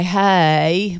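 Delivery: Southern "hey" stretched into several syllables; drawn-out Southern greeting. Retold or imitated. Imitating Southerners greeting a friend, long Southern drawl. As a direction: draw the word out in a Southern dialect. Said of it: "it was four syllables long".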